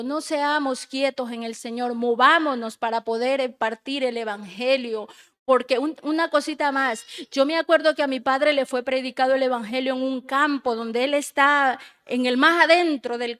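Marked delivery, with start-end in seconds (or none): none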